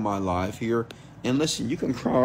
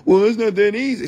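higher, whining put-on voice; mock whiny excuse; 10 semitones higher, 4 dB louder than usual. Mocking smokers who say quitting is hard, whiny voice. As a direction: whine it like a helpless quitter.

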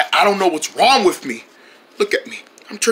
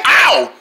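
pained yelp; 3 semitones higher than usual. Acted. Hurt finger again.

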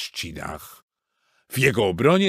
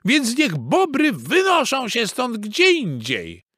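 high, indignant hen; clucking hen voice; 14 semitones higher, 4 dB louder than usual. A narrator's reading. Hens demanding the beavers leave, clucking. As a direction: squawk it like a cross hen. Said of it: "zagdakały tylko kury".

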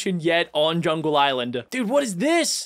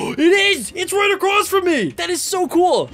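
high and loud with delight; thrilled shout of discovery; excited; 10 semitones higher, 5 dB louder than usual. Real, not acted. Finds the campsite, thrilled.

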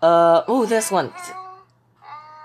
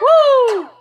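excited cheer; 8 dB louder than usual. Real, not acted. Cheering along with the video.

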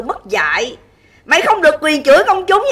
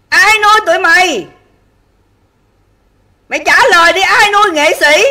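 raised, louder challenging questions; angry demand; 3 semitones higher, 5 dB louder than usual. Real, not acted. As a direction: demand an answer, pitched up and forceful. Livestream rant, demanding an answer.

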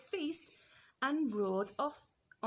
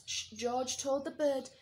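ordinary delivery

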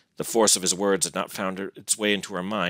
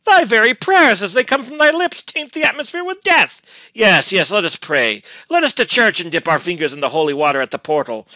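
loud, high, bitter mockery; fiendish scorn; 14 semitones higher, 10 dB louder than usual. A narrator's reading. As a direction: jeer furiously, voice soaring. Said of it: "still with the same fiendish scorn".

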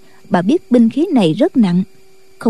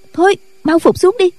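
high, hurried plea; a light girlish urge to get down; urgent young nun; 8 semitones higher than usual. Acted. Nghi Lâm pulls his hand, urging him down, high hurried voice. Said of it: "Nghi Lâm kéo tay chàng".

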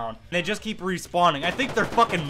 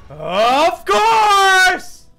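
high sarcastic yell; sarcastic scream; 13 semitones higher, 9 dB louder than usual. Real, not acted. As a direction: cry out in bitter irony.

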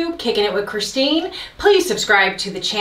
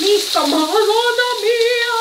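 excited exclamation; 13 semitones higher than usual. Real, not acted. Cheering herself on.

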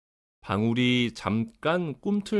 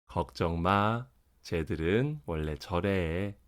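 calm mother; 4 semitones lower than usual. Acted. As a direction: gently settle the child's worry. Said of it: "엄마가 말했어요". (다정한 엄마 목소리)

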